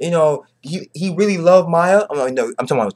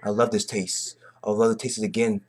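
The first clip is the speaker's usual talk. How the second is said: soft, low wine-sipping purr; hushed, smug villain relishing the taste; savoring villain; 5 semitones lower, 9 dB quieter than usual. Retold or imitated. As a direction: savor every word like a fine wine. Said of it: "He was sipping that wine like".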